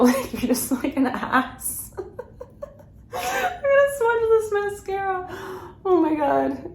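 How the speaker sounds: high, helpless laughter through the words; cracking up. Real, not acted. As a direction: talk through a real laughing fit. Cracks up explaining a rude word.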